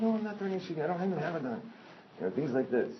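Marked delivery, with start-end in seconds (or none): 0.0-1.6 high-pitched retold protest; indignant protest. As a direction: act out protesting in a raised pitch. Retold protest in heated doorstep argument.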